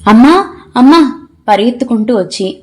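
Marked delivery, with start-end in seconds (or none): 0.1-1.3 higher, slow, drawn-out call for mom; children crying out for their mother. A narrator's reading. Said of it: "ఒకేసారి గట్టిగా అరిచారు". (పిల్లలు అమ్మని గట్టిగా పిలుస్తూ)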